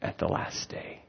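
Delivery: fading to whisper. Retold or imitated. Martha answering Jesus, quiet, fading to a whisper. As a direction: let her faith trail into a whisper. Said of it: "And she says".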